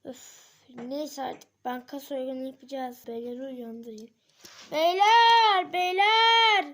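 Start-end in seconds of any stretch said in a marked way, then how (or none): 4.7-6.7 loud sing-song call to the guys. Acted. Waking the gang, calling them loudly. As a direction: belt it out to wake them.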